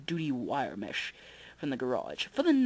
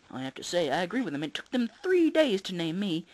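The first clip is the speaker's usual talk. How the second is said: usual speaking voice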